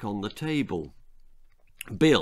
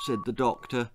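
plain reading voice

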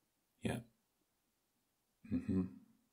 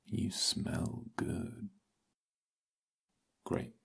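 slow, close whisper in the ear; much slower than usual. Acted. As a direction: whisper it slowly, right at the ear. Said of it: "I'm going to whisper in your ears".

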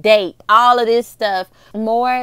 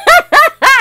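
loud high laugh; 11 dB louder than usual. Real, not acted. Laughing at her own comeback to mom, high loud laugh.

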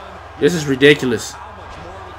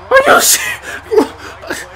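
high, drawn-out squeal; slow high whine of disbelief; despairing disbelief; slower than usual. Real, not acted. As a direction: let the voice leap up helplessly. Another kick-out in game.

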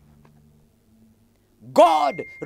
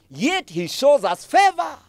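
high, loud preaching cry on favor; preaching shout; 8 semitones higher, 4 dB louder than usual. Real, not acted. Preacher to congregation about God's favor, full shout.

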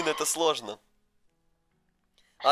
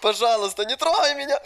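high panicked pleading; being chased; 9 semitones higher, 5 dB louder, slower than usual. Real, not acted. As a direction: squeal no and beg to be spared. Being caught by the hunter.

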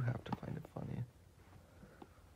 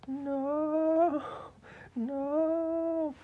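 very high, loud drawn-out no; anguished scream; 8 dB louder than usual. Acted. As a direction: wail it out in cartoon grief. Hero mourning his friend.

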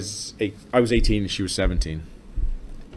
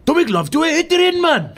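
put-on Welsh accent. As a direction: shout it like a Welsh farmer. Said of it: "I love that accent you did there".

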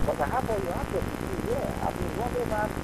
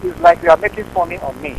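louder, offended complaint; indignant loud protest; 10 dB louder than usual. Real, not acted. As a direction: push back hard at the mockery. Caller angrily protests.

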